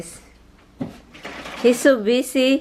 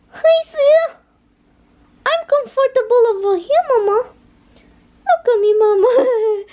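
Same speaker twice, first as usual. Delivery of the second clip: much higher, louder squeaky voice; cutesy pet voice; 13 semitones higher, 5 dB louder than usual. Pretend. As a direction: speak for him like a little pet. Voices the husband speaking to mama.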